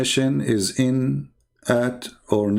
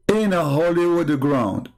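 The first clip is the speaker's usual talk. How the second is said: usual speaking voice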